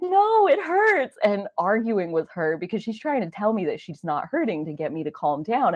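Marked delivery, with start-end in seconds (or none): 0.0-1.2 put-on very high, slow childlike wail; crying child. Child arguing with grandma through tears, slow high wail. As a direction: drag it out like a tearful little kid. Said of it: "And I'm like".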